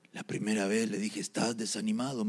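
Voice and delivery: low, soft report from the demon; subdued demon recounting a first try; first, weaker attempt. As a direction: start small and low, held back. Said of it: "La segunda vez con más fuerza".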